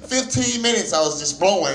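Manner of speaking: ordinary delivery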